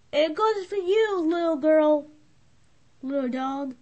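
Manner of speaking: ordinary delivery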